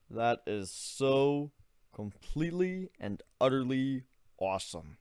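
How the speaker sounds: lost in amazement; a slow, hushed voice full of awe; awed hush. Acted. Ivan stands there in awe.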